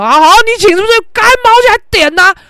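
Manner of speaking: high, loud, sarcastic comeback; mock sarcastic retort. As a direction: pretend to snap back mockingly. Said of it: "不至于".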